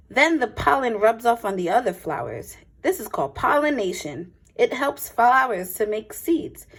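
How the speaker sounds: clear, lively picture-book read-aloud; a teacher's storytime voice, a touch brighter; reading to children. Acted. Reads picture book to class.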